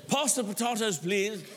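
hammed-up British accent with long vowels; mock Elizabethan English. Guest at the heavenly supper, mock Elizabethan British accent. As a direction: put on a genteel British table voice. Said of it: "in Elizabethan, Elizabethan English".

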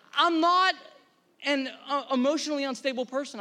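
high-pitched comic denial; lightly hammed-up self-defence; mock protest. Acts out protesting own tears.

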